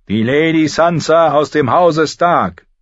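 high, loud formal announcement; herald's call. A narrator's reading. Herald presents Lady Sansa to the throne, loudly. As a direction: announce her name to the hall. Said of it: "rief der Herold".

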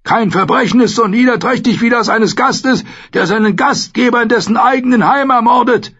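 very high, sustained furious shouting; screamed outrage. A narrator's reading. Lord Commander over the murdered host's corpse, screaming. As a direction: condemn them at a strained scream. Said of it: "schrie er".

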